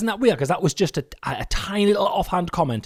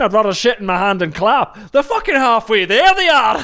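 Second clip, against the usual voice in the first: high, loud Scottish impression; rising, rowdy put-on Scottish accent; 3 semitones higher, 6 dB louder than usual. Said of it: "said you had a good Scottish accent".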